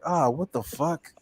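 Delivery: high, soft dismay; shocked viewer. Retold or imitated. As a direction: recoil in disbelief. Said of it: "you're like".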